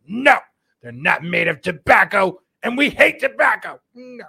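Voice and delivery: hammed-up bureaucrat protesting; FDA impression. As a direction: play a preachy regulator scolding. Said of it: "It's my best F D A impression".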